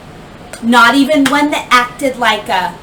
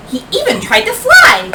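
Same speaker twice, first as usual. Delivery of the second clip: high excited lift; 11 semitones higher, 4 dB louder than usual. A narrator's reading. Pitch leaps up for flying.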